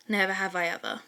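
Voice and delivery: plain reading voice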